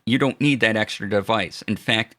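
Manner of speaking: ordinary talk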